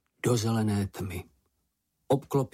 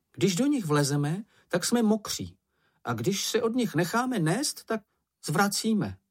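high-pitched fretful grumbler; timid, glum Čenich; 9 semitones higher than usual. Acted. Čenich explains his dislike of waves, high fretful voice. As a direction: object in a small high voice.

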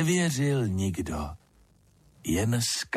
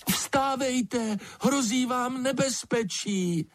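high-pitched wake-up call; calling a warning; 13 semitones higher than usual. A narrator's reading. Lizard warns the sleepers of danger, high calling voice. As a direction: call out high to rouse sleepers, without shouting.

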